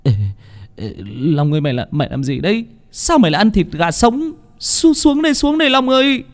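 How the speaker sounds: alarm climbing into a high-pitched call; panicked yell. A narrator's reading. Phong yells to Long, who is eating raw chicken, panicked yell. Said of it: "Thằng Phong hốt hoảng hét lên".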